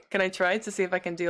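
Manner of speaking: ordinary talk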